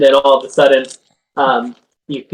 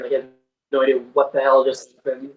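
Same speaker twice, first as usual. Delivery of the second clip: usual speaking voice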